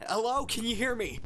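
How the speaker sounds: loud, high urgent check; calling out through static. Acted. Caller as the line fills with static, urgent shout. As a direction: raise your voice to be heard on a bad line.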